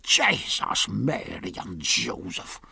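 a low, hushed oath under the breath. A narrator's reading. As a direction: mutter it very low and rough. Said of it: "though very low".

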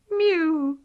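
a weak, trembling little mew; frightened kitten at sunrise; thin shaky mew. A narrator's reading. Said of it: "in a thin, shaky voice".

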